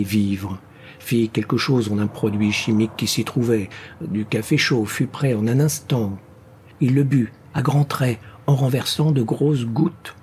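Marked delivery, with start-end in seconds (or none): none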